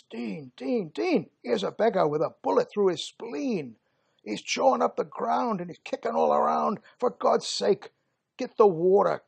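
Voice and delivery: high, loud pleading that builds to a cry; desperate call for water. Acted. Soldier begging Gunga Din to bring water to the wounded, desperate and loud. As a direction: beg for water for a dying friend.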